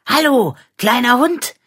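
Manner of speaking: high bright calling hello; cheerful greeting. Acted. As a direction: call out a happy hello.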